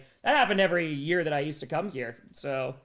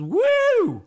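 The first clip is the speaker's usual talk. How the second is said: loud, high-pitched "woo!"; sudden piercing woo; high whoop; 8 dB louder than usual. Real, not acted. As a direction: let out a big whoop. Whoops amid reading fan message.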